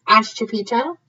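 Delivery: ordinary delivery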